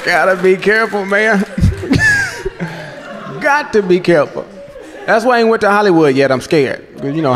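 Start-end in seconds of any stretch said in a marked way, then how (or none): 1.5-2.9 squealed high laugh, softer; high squealing laugh. Real, not acted.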